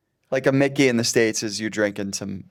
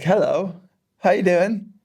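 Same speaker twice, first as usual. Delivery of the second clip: friendly greeting; 9 semitones higher, 5 dB louder, slower than usual. Pretend. Guest acts out the greeting's meaning.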